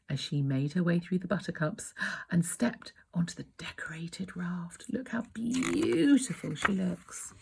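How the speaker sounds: hushed whisper. Real, not acted. Parent reading to a small child, whispering in wonder. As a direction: breathe the words softly. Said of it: "whispered aside to child".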